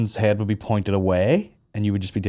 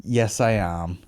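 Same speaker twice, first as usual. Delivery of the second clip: ordinary delivery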